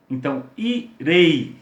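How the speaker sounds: 'irei' slow, leaning on the 'e'; teacher stressing the vowel of 'irei'. Shown as a demonstration. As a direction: draw out 'irei', pressing the strong 'e'. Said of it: "qual que soou mais forte? O e".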